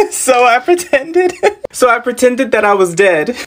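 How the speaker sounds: amused, high-pitched giggly retelling; laughing. Real, not acted. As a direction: crack up while telling it. Cracks up telling fake death.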